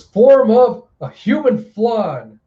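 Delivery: very slow, high mock incantation; theatrical transformation call. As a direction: stretch it out high and loud like a showman. Character announces a transformation.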